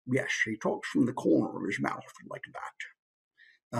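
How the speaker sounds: drawn-out Scottish film star impression; Sean Connery. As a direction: talk out of one corner of the mouth. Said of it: "Sean Connery is another famous one".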